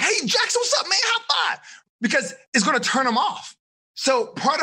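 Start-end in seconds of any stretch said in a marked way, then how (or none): 0.0-1.6 mock hyper greeting, pitched way up; over-energetic greeting. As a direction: burst in way too turned up. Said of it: "I can't come in like".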